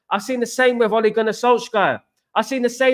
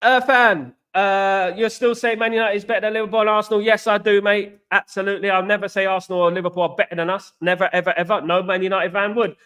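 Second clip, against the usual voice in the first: ordinary talk, nothing marked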